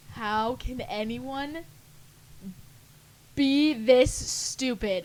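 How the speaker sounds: slow, drawn-out incredulous question; exasperated disbelief. Retold or imitated. Watching waitress story videos, slow exasperated disbelief. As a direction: stretch every word in disbelief. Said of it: "I'm like".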